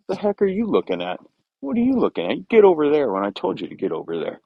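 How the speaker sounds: mock indignant mare, pitched well up; ornery lead mare. Lead mare scolding a horse that ignored her, hammed-up ornery voice. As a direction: play the cranky boss mare demanding what he's staring at. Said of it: "they're just like".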